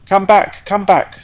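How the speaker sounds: high, loud desperate scream. Acted. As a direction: call after her in a panic. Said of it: "screamed the rocket".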